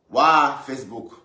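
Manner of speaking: loud, drawn-out wow of disbelief; a slow, loud cry of amazement; stunned wow. Real, not acted. Reacting to mother exposed on Facebook. (stupeur, fort et lent)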